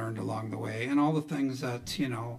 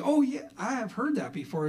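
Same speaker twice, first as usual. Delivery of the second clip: higher-pitched imitated wife; pitched-up, insistent recognition; certain wife; 9 semitones higher than usual. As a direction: lightly voice a wife who is sure of it. Wife insists, retold dialogue.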